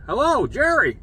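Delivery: high, loud singled-out greeting; calling to rouse. Retold or imitated. Doctor waking a patient after surgery, calling out. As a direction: imitate a doctor hailing a groggy patient.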